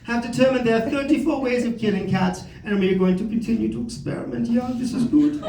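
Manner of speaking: hammed-up German institute researcher; nefarious German accent. German organization reporting on its cat studies, nefarious German accent. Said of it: "some maybe nefarious German organization".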